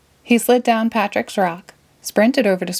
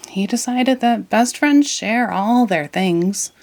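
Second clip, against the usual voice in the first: usual speaking voice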